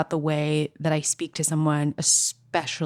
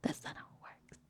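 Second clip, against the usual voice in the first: unvoiced whisper; 20 dB quieter than usual. Shown as a demonstration. Comic correcting herself in a hushed whisper. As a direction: breathe it as a secret. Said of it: "repeated in different voices".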